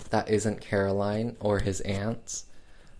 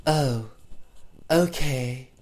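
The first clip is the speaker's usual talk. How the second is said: unbothered put-on actress voice; drawn-out mock acceptance, pause after the oh; Faye Dunaway as Barbara; 3 semitones higher, much slower than usual. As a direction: imitate a token, unremorseful reaction. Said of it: "Faye Dunaway sitting on the bed going like".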